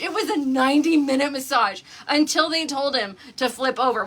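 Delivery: ordinary delivery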